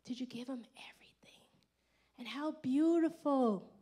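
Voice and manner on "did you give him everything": quick hushed whisper. Real, not acted. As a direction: whisper it urgently. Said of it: "whispered sermon question".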